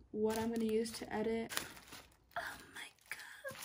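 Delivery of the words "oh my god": breathless whispered awe; hushed amazement; awed whisper. Real, not acted. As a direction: whisper it in disbelief. Awed at seeing iPad.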